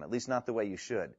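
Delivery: normal talking voice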